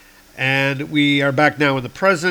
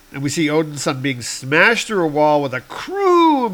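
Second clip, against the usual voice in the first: ordinary delivery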